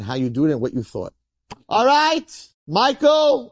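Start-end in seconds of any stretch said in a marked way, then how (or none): 1.7-2.5 high-pitched sustained call. Acted. Heavenly judge opens the weighing, high calling out. 2.7-3.5 high, shouted "Michael" in English; calling out. Acted. Heavenly judge summons Michael by English name, high call.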